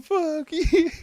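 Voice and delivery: loud, high mock shout; playful shout. Streamer reenacting shouting at Crystal, loud playful shout. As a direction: shout it at a friend in fun. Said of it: "I'm like".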